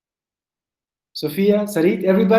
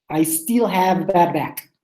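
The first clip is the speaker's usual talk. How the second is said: slow, careful "I still have bad back"; slow model; 3 semitones lower, slower than usual. Shown as a demonstration. Teacher models a tricky sentence for the class, slowly. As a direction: spell the sentence out slowly for learners. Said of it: "Can you say it quickly?".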